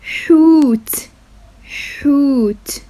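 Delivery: حوت said slowly with a breathy throaty h; حوت, slow and deliberate; Arabic word said slowly. Shown as a demonstration. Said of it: "K H is pronounced خ".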